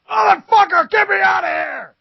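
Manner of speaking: very high, strained yell for rescue; acted frantic cry, loud and high; panicked shout. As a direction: scream as someone trapped in a fire. Trapped man yells from burning house.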